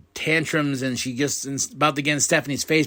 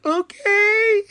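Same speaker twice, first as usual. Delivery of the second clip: very high, loud mock falsetto; tiny-voiced hammed-up compliance; timid squeak; 5 dB louder than usual. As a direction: squeak it out obediently. Imitates Kairi answering the scream.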